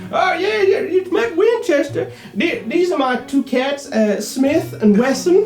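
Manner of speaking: high-pitched eccentric host; animal owner. Acted. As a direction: show off your pets in a high voice. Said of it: "he comes back out and he says".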